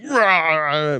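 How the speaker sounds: high mock roar of frustration; mad growl. Man retelling his anger at God, mock roar. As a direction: let out a comic exasperated "rah". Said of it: "I was so mad".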